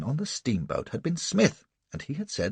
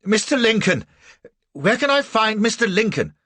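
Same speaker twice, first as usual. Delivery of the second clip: much higher, louder desperate call; desperate cry; 11 semitones higher, 6 dB louder than usual. A narrator's reading. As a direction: cry out for help, gasping. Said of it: "find the breath to cry out".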